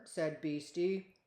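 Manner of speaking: ordinary delivery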